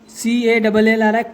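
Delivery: ordinary delivery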